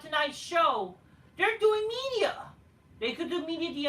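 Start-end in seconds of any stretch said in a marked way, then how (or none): 1.4-2.3 mocking. Mocking excuse, pitched up.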